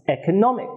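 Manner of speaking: "economic" with main stress on "nom"; stress on third syllable. Shown as a demonstration. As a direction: lighter beat on "e", strongest on "nom" in "economic". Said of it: "it has stress on the third syllable".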